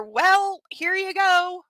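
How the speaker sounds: high, fast sarcastic scolding of the couple; mock him for rushing into marriage; sarcastic rant. Real, not acted. Scolding the book's couple, high and sarcastic. Mocking the character's choices.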